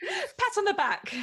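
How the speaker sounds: laughing. Real, not acted. Touched, laughing praise.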